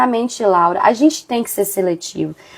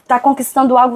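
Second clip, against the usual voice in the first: usual speaking voice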